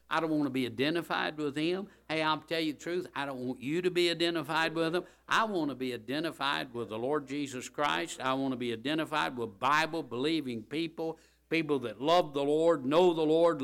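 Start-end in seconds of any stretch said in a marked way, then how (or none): none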